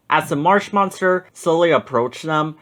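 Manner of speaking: ordinary delivery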